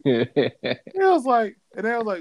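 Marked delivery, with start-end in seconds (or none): none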